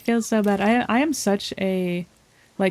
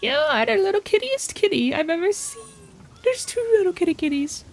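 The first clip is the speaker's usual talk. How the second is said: cooing at a kitty; 9 semitones higher than usual. Real, not acted. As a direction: coo at the kitty, much higher. Gushing over two kittens.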